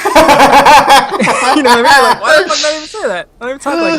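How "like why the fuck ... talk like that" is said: high, loud, laughing exasperation; mock self-disgust. Worker appalled at own fake greeting, loud comic exasperation. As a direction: burst out baffled at your own fake voice.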